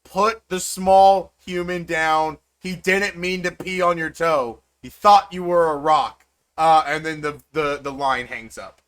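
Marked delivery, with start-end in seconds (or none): 0.0-6.3 roaring in giant. Acted. Mom roaring at a giant to free the wizard, loud. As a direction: bellow it at the giant. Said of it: "You hear your mom roar back in giant".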